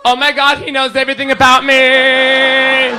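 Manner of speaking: high sing-song girly imitation; smitten girl. Girl won over by fake astrology, imitated in a high gush. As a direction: gush it high, stretching the last word.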